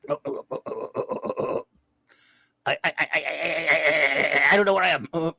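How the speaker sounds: repeated stuttering syllables like a broken android; mock malfunction; hammed-up machine glitch; glitching robot stutter. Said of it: "I'm Max Headroom".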